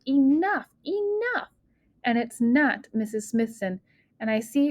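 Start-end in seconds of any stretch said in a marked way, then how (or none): 0.1-0.7 firm narrated yell, a bit louder. A narrator's reading. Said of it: "a voice yells". 0.9-1.5 jumps much higher, almost a shriek; high yell. A narrator's reading. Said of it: "a voice yells".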